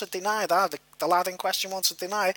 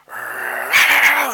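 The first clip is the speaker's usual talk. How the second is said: louder low dog growl; 8 semitones lower, 5 dB louder than usual. Retold or imitated. The dog about to attack, a louder growl. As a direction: imitate the dog growling before it jumps. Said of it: "the dog went".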